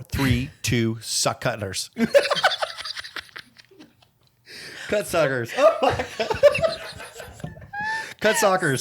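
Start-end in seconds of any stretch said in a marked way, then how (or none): 1.9-8.8 cackling at a slip of the tongue; laughing hard. Real, not acted. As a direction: crack up over the botched tongue twister.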